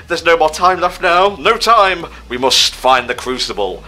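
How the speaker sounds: mock dramatic.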